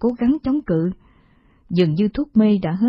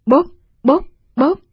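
slap sounds; 7 semitones higher, 7 dB louder than usual. Acted.

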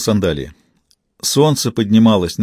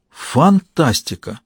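drawn-out, higher cry of wonder; delighted exclamation; 5 semitones higher, much slower than usual. A narrator's reading. Girl marvels at the boy's seaside hut, exclaiming in delight. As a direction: exclaim it slowly, savouring the amazement. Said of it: "воскликнула Алисия".

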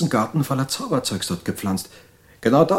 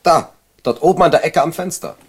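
angry insisting; 6 semitones higher, 5 dB louder than usual. Acted. Angry George insists on spider.

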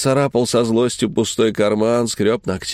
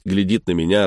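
ordinary delivery, nothing marked

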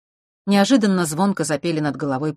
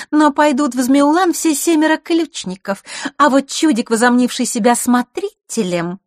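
high, loud girlish mockery; jeering; 10 semitones higher, 6 dB louder than usual. A narrator's reading. Girl belittles the would-be keeper, jeering. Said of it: "с издёвкой заявила девчонка".

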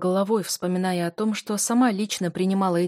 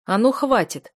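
loud, abrupt order to stop; sharp command; 4 semitones higher, 6 dB louder than usual. A narrator's reading. Friend stopping the couple's quarrel, sharp and loud. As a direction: cut the quarrel off. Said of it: "вдруг резко сказал Бертран".

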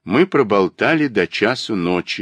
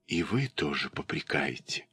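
hushed, tearful, much softer and lower; tearful whisper; 5 semitones lower, 13 dB quieter than usual. A narrator's reading. Polya whispers her hurt to Egor, tearful and hushed. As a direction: barely voice it, near tears. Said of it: "тихо, со слезами на глазах, прошептала".

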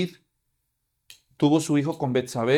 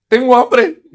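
put-on a loud, higher little girl's voice; hungry girl; 7 semitones higher, 11 dB louder, slower than usual. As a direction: play the healed girl asking for food. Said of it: "lo primero que ha de haber dicho es". (niña recién curada diciendo que tiene hambre)

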